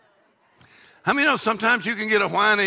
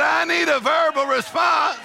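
God demanding; 10 semitones higher than usual. Acted. Preacher voicing God, building to a shout.

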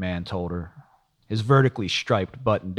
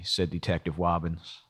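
ordinary delivery

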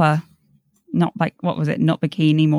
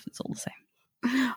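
soft, slow, hushed; whispered aside; 6 semitones higher, 12 dB quieter, slower than usual. Real, not acted. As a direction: drop to a whisper. Muttered aside after the quote.

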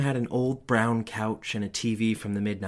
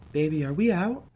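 mother's question; 7 semitones higher, 5 dB louder than usual. A narrator's reading. Mom asking the child.

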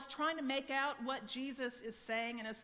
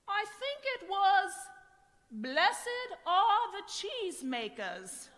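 comic mishearing; 6 semitones higher, 5 dB louder, slower than usual. Retold or imitated. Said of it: "man number one says".

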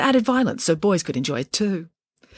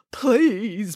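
begging; 4 semitones higher than usual. A narrator's reading. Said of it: "begged the manager".

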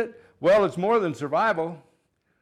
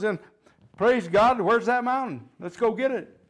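rousing preaching; 4 semitones higher than usual. Acted. Rousing the congregation to act.

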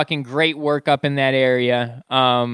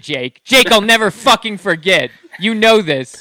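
loud, mock-serious grudge; mock angry vow; 5 semitones higher, 7 dB louder than usual. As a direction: swear it loudly with fake fury. Vowing never to forget.

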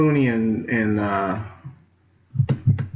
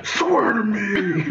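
slow, raspy growled Batman impression; Bale Batman voice; 9 semitones higher, much slower than usual. Mocking Bale's Batman voice to her co-host. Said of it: "that voice".

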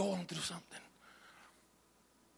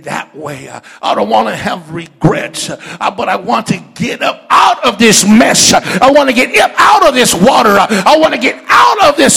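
full-force preaching; 22 dB louder than usual. Real, not acted. Preaches a prayer, building to shouts.

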